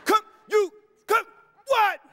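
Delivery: high, loud, snapping at the family; retold angry yell. To her household after waking up mad, a shouted snap. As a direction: bark it at the house like you woke up mad. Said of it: "I said".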